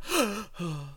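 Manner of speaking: sharp shocked gasp. Retold or imitated. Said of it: "I gasped".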